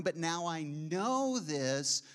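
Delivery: slow, drawn-out Now I know; slow realization. Retold or imitated. Preacher voices a believer realizing, slowly. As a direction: let the realization land slowly.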